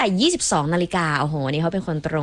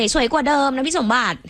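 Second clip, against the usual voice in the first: laughing tease; 7 semitones higher than usual. Real, not acted. Teasing reply to compliment.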